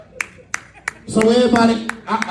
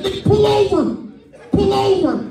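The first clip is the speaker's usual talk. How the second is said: retold road-rage yell; 9 semitones higher than usual. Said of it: "That motherfucker said".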